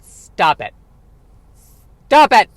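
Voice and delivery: rising to a loud, high yell; mock self-scolding shout. Scolding his own bad impression, loud mock yell. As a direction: shout yourself down in mock annoyance. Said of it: "are you trying to do Sean Connery?".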